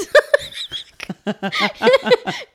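long high laugh, rising; high giggle. Real, not acted. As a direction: giggle high and loud at yourself. Laughs at her own story.

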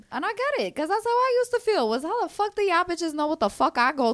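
high, loud indignant outburst. Retold or imitated. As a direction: let the frustration burst out loud. Voicing her old angry self.

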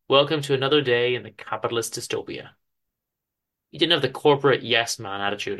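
ordinary delivery, nothing marked

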